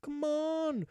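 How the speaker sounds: impatient Hrothgar. Retold or imitated. Hrothgar urging the unmoving invader to fight, high and impatient. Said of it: "So Hrothgar was like".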